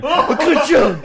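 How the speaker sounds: slow printing press voice. Pretend. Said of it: "you've done that".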